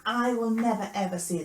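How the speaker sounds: loud, insistent promise that eases off at the end; emphatic vow. Acted. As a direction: swear it loudly and firmly. Peter's vow to Jesus, acted.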